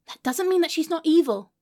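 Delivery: normal talking voice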